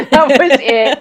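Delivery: laughing. Real, not acted. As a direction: laugh the words out. Laughing at the priest's punchline.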